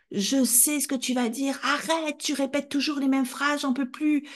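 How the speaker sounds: high, exasperated shouting; child shouting that the mother repeats herself; angry shout. Retold or imitated. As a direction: shout over her, fed up. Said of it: "Je criais".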